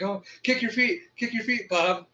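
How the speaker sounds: high-pitched mock urging; imitated panic. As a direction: act out fretful onlookers coaching a swimmer. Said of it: "everyone else is panicking".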